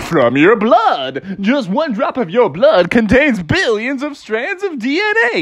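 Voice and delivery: swooping, sing-song educational mascot; chirpy Mister DNA. Acted. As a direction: stretch it out like a cartoon science host. Voices cartoon Mister DNA.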